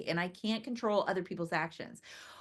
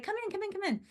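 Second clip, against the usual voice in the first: welcoming; 12 semitones higher, 4 dB quieter, much faster than usual. Retold or imitated. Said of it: "I was like".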